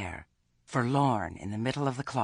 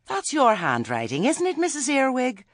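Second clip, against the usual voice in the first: high, loud, prim accusation; accusing committee lady; 7 dB louder than usual. A narrator's reading. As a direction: pin her down with shrill-free righteous indignation. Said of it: "she said".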